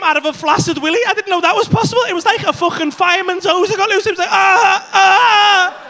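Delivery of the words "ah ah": higher, louder ah ah; hammed-up crying out; mock anguished cries. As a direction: yelp like it burst out. Retold release cries.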